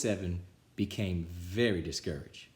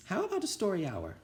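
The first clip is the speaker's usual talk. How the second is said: sweet teacher; 10 semitones higher, faster than usual. A narrator's reading. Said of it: "Missus Nelson".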